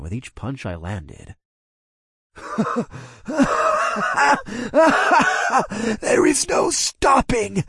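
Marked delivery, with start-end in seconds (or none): 2.4-6.0 high, taunting monster laugh; evil laugh. Acted. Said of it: "The Shadow Man started to laugh". 6.0-7.6 high, slow, taunting creature voice; mock him from the shadows; taunting monster. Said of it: "he taunted".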